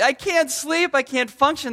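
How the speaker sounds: high-pitched mock kid plea; hammed-up bedtime complaint; overwhelmed child. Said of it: "They're just completely overwhelmed".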